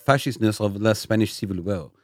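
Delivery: put-on French expert, stumbling a little; mock-earnest French accent. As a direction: keep up the comic French accent. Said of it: "trying to do a French accent".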